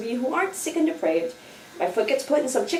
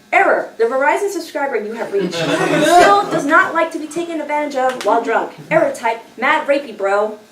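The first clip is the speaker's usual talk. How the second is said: louder mock phone-carrier recording; automated error message; 3 semitones higher, 6 dB louder than usual. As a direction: announce it like an automated subscriber notice. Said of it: "I responded to Dickbag with".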